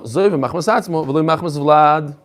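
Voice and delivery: usual speaking voice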